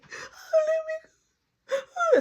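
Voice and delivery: high, whimpering plea; imitated tearful squeal; mock crying plea. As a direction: whine it as if pinned down. Imitates crying boy held down.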